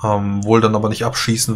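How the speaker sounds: normal talking voice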